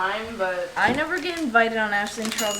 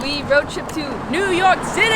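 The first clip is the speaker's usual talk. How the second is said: loud, high, slow call; shouting; 6 semitones higher, 3 dB louder, slower than usual. Real, not acted. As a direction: yell it out the car window. Shouting from the car.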